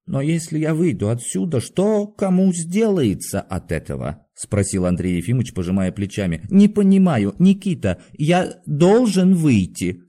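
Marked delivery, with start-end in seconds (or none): 0.1-4.3 bewildered pleading. A narrator's reading. Doctor pleads with the guard to go out, bewildered. 6.5-10.0 high, louder, slowing plea; trembling voice. A narrator's reading. Doctor demands the guard let him out, voice trembling. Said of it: "сказал он дрогнувшим голосом".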